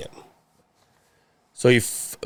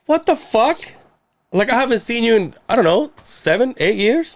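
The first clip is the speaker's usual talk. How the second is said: high-pitched exasperation; retold outburst, voice pitched way up; incredulous anger; 10 semitones higher than usual. Son to dad unseen for years, incredulous and angry.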